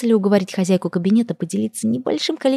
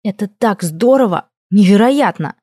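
slow, emphatic delight, building louder; excited; 3 semitones higher, 4 dB louder than usual. A narrator's reading. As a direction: marvel aloud, stretching the words. Heroine thrilled by the treasures.

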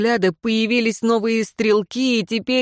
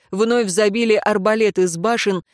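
ordinary delivery, nothing marked

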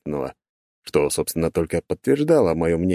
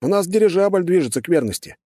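urgent; 11 semitones higher than usual. Acted. Urgent report to guard.

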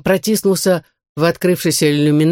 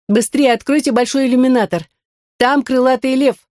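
higher urgent shouting; 8 semitones higher than usual. A narrator's reading. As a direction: shout it from the doorway. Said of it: "закричала она с порога".

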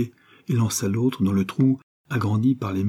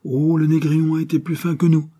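higher, louder admiring cry; exclamation; 8 semitones higher, 4 dB louder than usual. A narrator's reading. Laurent exclaiming to the others, admiring. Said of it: "s'écria Laurent".

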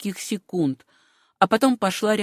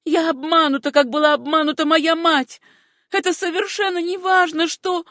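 deadened despair; 7 semitones higher, 3 dB louder than usual. A narrator's reading. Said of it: "омертвевшим голосом".

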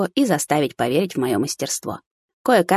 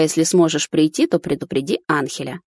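plain reading voice

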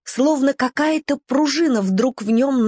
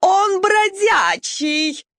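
high, slow, shouted wail; shrieked outrage; 10 semitones higher, much slower than usual. A narrator's reading. Nelli shouting to the dogs about the stray puppy, high wail. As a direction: howl it in scandalised horror. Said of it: "взвыла Нелли".

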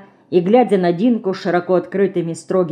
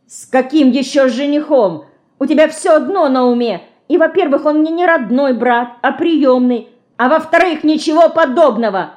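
higher, heated denial; flaring shout; 7 semitones higher, 4 dB louder than usual. A narrator's reading. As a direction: flare up and protest loudly. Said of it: "вспыхнув, закричала Динка".

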